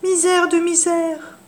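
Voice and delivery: put-on high, slow old crone's lament; hammed-up old crone woe; witch groaning. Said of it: "dit la sorcière".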